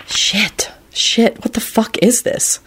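hushed, half-whispered cursing; shaken. A narrator's reading. Lupin alone pacing the sidewalk, shaken. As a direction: curse under the breath, rattled.

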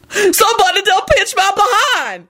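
high-pitched outraged holler. A narrator's reading. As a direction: holler it out in alarm. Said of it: "she hollered".

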